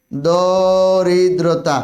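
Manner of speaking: দরিদ্রতা drawn out very slowly; careful, very slow দরিদ্রতা; slow spelling demo. Shown as a demonstration. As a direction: stretch দরিদ্রতা so each sound is clear. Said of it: "দেখো".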